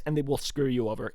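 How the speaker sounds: ordinary delivery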